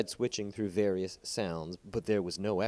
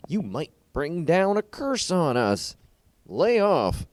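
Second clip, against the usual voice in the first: put-on loud, higher, slow warning from an old man; 10 semitones higher, 8 dB louder, slower than usual. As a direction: scold the young man to stop. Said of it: "warned an old man".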